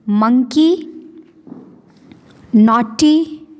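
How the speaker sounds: each word spaced, said clearly for repeating; reading words aloud for class. Shown as a demonstration. Teacher to class, modeling words to repeat, clear and spaced. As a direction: model each vocabulary word slowly for the kids to echo. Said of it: "बोलो".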